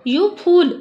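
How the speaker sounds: put-on boy shouts a prank into his cousin's ear, loud and high. A narrator's reading. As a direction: yell it suddenly to startle her. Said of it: "आणि मोठ्याने ओरडला".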